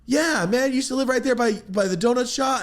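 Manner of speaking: chirpy mock of the old customer; imitating Michael. Michael reminding the speaker where he lived, high and eager.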